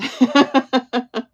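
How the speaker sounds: amused laugh to viewers. Real, not acted. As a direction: laugh out loud at the chat. Laughing after "Go ahead.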